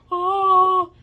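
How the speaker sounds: loud, high drawn-out "Oh!"; mock awed cry. Retelling the lights suddenly coming on, mock awe. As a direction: belt out one long "oh" of wonder.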